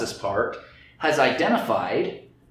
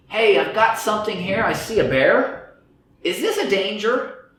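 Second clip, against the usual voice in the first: calling out; 7 semitones higher, 3 dB louder than usual. Acted. Unconscious brain calling out.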